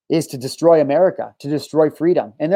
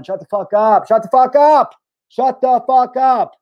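exasperated outburst; 6 semitones higher, 4 dB louder, slower than usual. Real, not acted.

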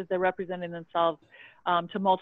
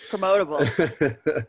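laughing echo; 3 semitones lower, 4 dB louder, slower than usual. Real, not acted. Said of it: "amused echo of the word".

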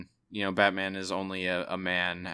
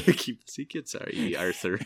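Willem Dafoe impression. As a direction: ham up a gruff old mentor, pitched up. Echoing friend's Willem Dafoe impression.

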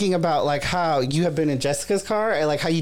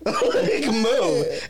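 higher laugh into move; 6 semitones higher than usual. Real, not acted. Retelling him poking her, laughing. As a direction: laugh while repeating it.

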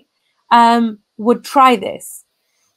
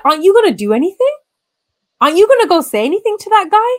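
higher, fast, pressing retold challenge; indignant demand; 9 semitones higher, faster than usual. Demanding her uncle confront the man on the train, higher and fast. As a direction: push him to act, rising in pitch.

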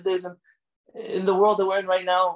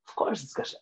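whispered, much softer, almost breathed; soft repeat; 3 semitones lower, 6 dB quieter than usual. Retold or imitated. Rabbi repeating to the boy, in a soft whisper. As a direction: let it fall to a hushed reassurance. Rabbi reassures child about candy.

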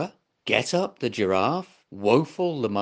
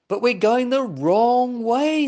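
higher, louder worried call; alarmed exclaim; 10 semitones higher, 6 dB louder than usual. Acted. Zebra warning they drift wrong, higher and louder. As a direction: sound the alarm, raised high. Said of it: "said Stripey the zebra".

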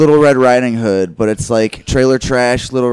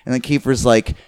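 ordinary talk, nothing marked

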